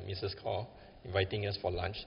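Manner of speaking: normal talking voice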